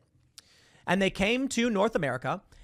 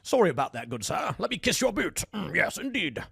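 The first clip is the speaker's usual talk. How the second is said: hammed-up British gentleman bowing and apologizing; posh British; 3 semitones higher than usual. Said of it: "a British guy kissing the boots".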